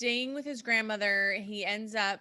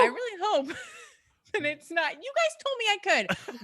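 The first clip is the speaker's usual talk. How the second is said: laughing; 10 semitones higher, 3 dB louder, faster than usual. Real, not acted. Embarrassed laughing admission.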